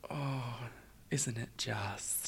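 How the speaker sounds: very soft, slow whisper; hushed whisper. Pretend. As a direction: whisper it in mock reverence. Hushed mock-reverent agreement on a pun.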